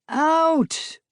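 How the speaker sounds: high, loud roar; the furious manager throws them out. A narrator's reading. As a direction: bellow him out the door. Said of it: "roared the manager".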